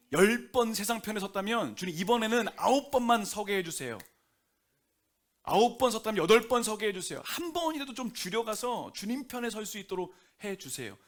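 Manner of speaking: loud, pleading prayer voice; fervent prayer. Acted. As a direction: cry out to the Lord on the congregation's behalf. Leads the congregation in prayer.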